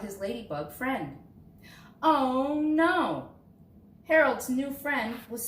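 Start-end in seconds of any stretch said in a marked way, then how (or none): 2.0-3.3 high, loud cry of alarm. A narrator's reading. Child reader alarmed at the trapped ladybug, loud and high. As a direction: gasp it out as if the story just went wrong. Friend stuck in spider web.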